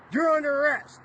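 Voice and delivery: high, loud command; stern arrest. Retold or imitated. A cop telling the inmate he is under arrest, loud. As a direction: bark it like a cop arresting someone.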